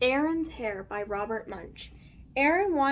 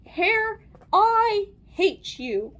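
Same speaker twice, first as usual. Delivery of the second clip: high, loud, drawn-out angry yell; mad yell; 7 semitones higher, 7 dB louder, much slower than usual. A narrator's reading. Aaron yells at his unruly hair, mad. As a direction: yell it slowly, stretching the words. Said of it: "he got so mad that he yelled".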